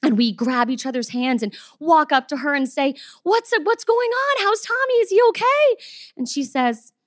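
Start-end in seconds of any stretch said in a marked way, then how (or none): 3.2-5.7 a touch of anxious high questioning. Retold or imitated. Owners rushing up to the vet for news, high and anxious. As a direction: fire the questions up high, worried.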